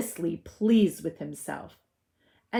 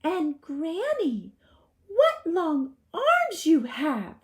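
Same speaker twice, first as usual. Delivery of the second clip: put-on 1 little girl; 10 semitones higher, 4 dB louder, slower than usual. A narrator's reading. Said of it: "said the wolf".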